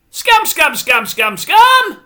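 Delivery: high, loud chant climbing to a yell; exasperated shouting. Real, not acted. Viewer listing a schedule full of scams, exasperated shouting. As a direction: fire the word out over and over, loudest last. Climax of rant, repeating the word.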